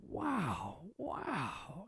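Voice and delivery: high, drawn-out wow; exaggerated impressed disbelief; mock amazement. Retold reaction to busy host.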